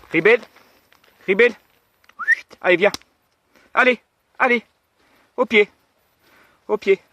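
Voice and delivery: high, loud calls to heel; talking to a dog. Real, not acted. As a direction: call the dog in firmly.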